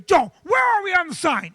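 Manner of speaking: high, loud demanding shout; impatient boss shout. Retold or imitated. Boss shouting at the sign guy for an update, loud and demanding. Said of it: "Billy goes".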